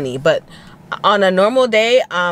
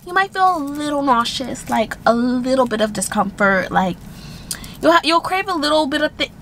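imitating video host; 7 semitones higher than usual. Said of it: "they're always like".

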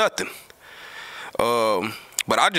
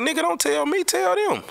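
much higher mock Big Mel, rising as he goes; imitating Big Mel. Big Mel telling him to ask the store staff, a much higher mock voice. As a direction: pitch climbing up to play Big Mel setting him straight. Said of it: "He was like".

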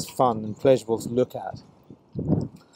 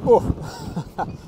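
startled oh; 7 semitones higher, 6 dB louder than usual. Real, not acted. Sudden gust of wind.

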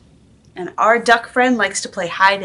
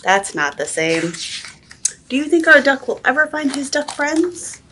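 ordinary delivery

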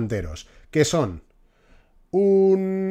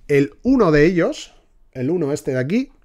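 ordinary talk

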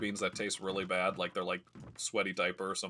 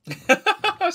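sudden shrieking laugh, much higher and louder; burst of laughter; 14 dB louder than usual. Real, not acted. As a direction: explode into a real laugh. Reacting to his own joke.

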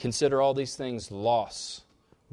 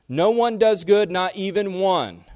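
scripture cried out, louder and higher; preaching loud; 6 semitones higher, 7 dB louder than usual. Acted. Preacher to congregation, reading Romans with a loud, raised voice. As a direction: proclaim it with rising force.

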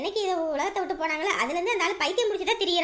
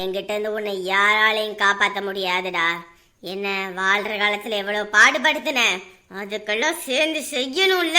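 menacing taunt; 7 semitones lower than usual. Acted. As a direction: gloat over the trapped man. Ghost threatening victim. (தாழ்ந்த மிரட்டும் குரல்)

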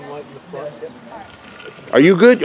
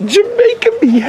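mock Jamaican pun.

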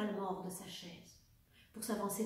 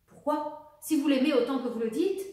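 higher, loud, indignant question; curt interruption; 5 semitones higher, 8 dB louder than usual. A narrator's reading. Baroness cuts off the officer about her daughter, curtly. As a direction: cut in sharply, voice rising at the end. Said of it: "le coupa sèchement la baronne".

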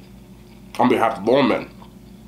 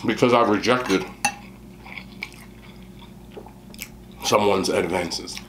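speaking between bites; talking while chewing; 9 semitones lower, 8 dB quieter, slower than usual. Real, not acted. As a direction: mouth partly full of food. Seen 0: Spoonful taken at -2; lips closed, jaw working between phrases.

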